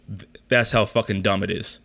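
usual speaking voice

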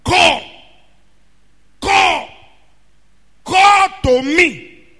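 preacher showing how to call out; stress on call. Shown as a demonstration. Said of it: "He didn't say, "Whisper to me".

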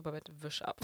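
low, soft undertone; retold hushed order. Friend telling Babette at the front to wipe it, retold hushed. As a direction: say it low and under the breath, imitating a discreet order.

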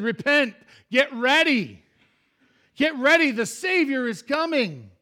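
high, loud preaching cry; urgent proclamation. Acted. Preacher voicing John warning the people, urgent shouted proclamation. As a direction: call the crowd out with full-voiced urgency.

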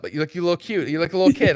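usual speaking voice

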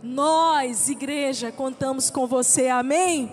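loud, forceful preaching; exhorting shout. Acted. Pastor rallying the church, loud exhortation. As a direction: thunder it over the crowd.

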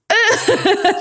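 high squeaky cry of disgust with a laugh; cringing squeal. Retold or imitated. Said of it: "I was like".

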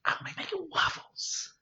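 breathy voiceless whisper. Pretend. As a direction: whisper the waffle line. Donkey line whispered.

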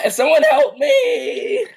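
very high, slow, laughing cry for help; laughing falsetto plea. Acted. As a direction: beg for help through laughter.

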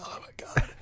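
spent, whispered relief; exhausted whisper. Retold or imitated. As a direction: breathe it out, whispered. Retold collapse back at home.

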